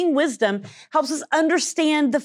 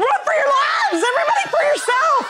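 high panicked yelling; yelling while running away; 11 semitones higher than usual. Acted. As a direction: scream it while fleeing in terror. Fleeing the loose cobra.

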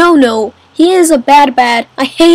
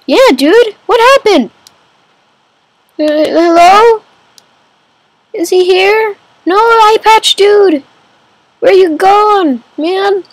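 higher, puzzled calls into the empty room; where did he go, call for him; raised, searching voice; 5 semitones higher than usual. Real, not acted. Missing hired man, calling for him.

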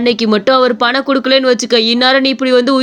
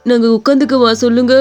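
ordinary delivery

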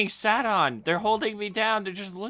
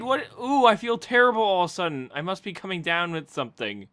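ordinary talk

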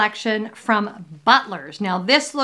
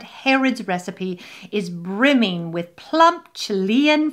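hammed-up English accent with lingering words; bad English accent. Vlogger reading a pudding label, bad English accent. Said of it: "English accent I have ever heard".

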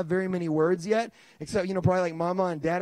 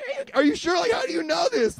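high, unsure questioning; wife voiced; 8 semitones higher than usual. Wife asking how he knows, high and unsure. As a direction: ask it doubtful, like the wife.

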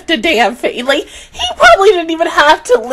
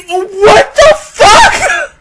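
slow, loud disbelieving laugh-shout; 6 semitones higher, 7 dB louder, much slower than usual. Real, not acted. Laughing shout at a shocking anime scene.

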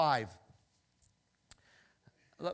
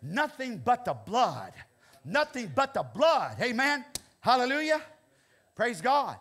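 loud, high preaching at full force; full-throated revival preaching; fiery preaching; 8 semitones higher, 11 dB louder than usual. Real, not acted. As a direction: preach it out with fire.

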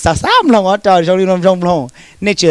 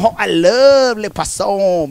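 mock French chef; slower than usual.

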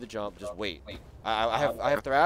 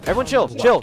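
loud call to chill; 9 semitones higher, 13 dB louder than usual. Real, not acted. Calming the team mid-round.

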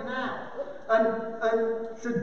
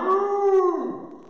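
long, higher-pitched moo; raised, held mooing; cow moo; 6 semitones higher than usual. Shown as a demonstration. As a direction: imitate a cow with a drawn-out moo. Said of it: "a cow".

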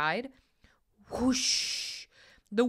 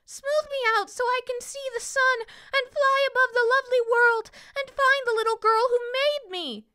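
high loud squeaky plea; 6 dB louder than usual. A narrator's reading. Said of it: "the princess squeaked".

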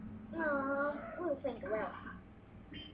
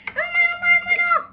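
very high loud squealing; excited squeal; 14 dB louder, faster than usual. Acted. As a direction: squeal with delight.

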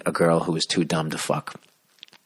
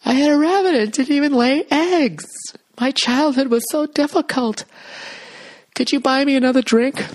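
very high falsetto girl impression; mock dumb-girl voice. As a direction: squeal it like a hammed-up ditzy woman. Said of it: "repeat the claim with even more vigor".

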